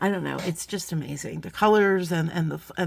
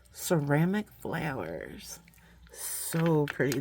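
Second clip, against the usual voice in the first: whispering; 4 semitones lower, 4 dB quieter, slower than usual. Real, not acted. Admiring beads up close.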